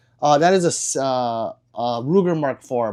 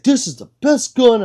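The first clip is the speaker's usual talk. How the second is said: high, loud imitation of a boaster; exaggerated mocking quote; mock gun fan; 11 semitones higher, 7 dB louder than usual. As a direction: mimic someone overhyping it. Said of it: "he's like".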